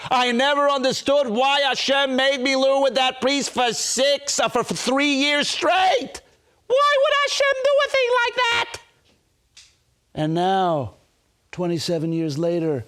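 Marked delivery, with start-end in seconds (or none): 0.1-8.8 high, shouted lament building to a cry; anguished outcry. Retold or imitated. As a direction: act out an old man's bewildered protest to heaven. Crying old man's anguished question.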